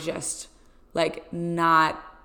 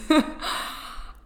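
breathy laugh; 10 semitones higher than usual. Real, not acted. Laughs after dramatic plea.

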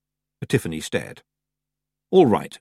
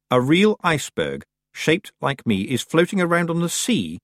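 higher, understated horror; horrified; 7 semitones higher than usual. A narrator's reading. Tiffany learning of the real iceberg, mildly horrified. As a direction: realise it with mounting dismay, voice raised. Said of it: "said Tiffany in horror".

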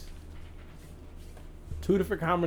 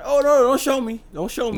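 mock alarm; 10 semitones higher, 7 dB louder than usual. Alarmed booth host acted.

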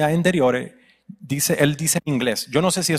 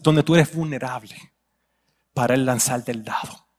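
normal talking voice

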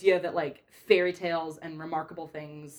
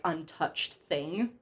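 usual speaking voice

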